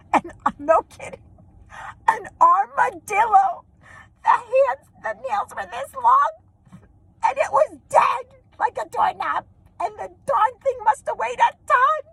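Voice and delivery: high squealing laughter through the words; high-pitched, breaking into giggles; cracking up. Real, not acted. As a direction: tell it while helpless with laughter. Cracking up at the armadillo reveal.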